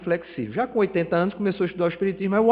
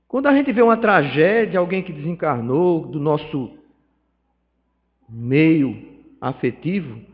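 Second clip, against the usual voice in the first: ordinary talk, nothing marked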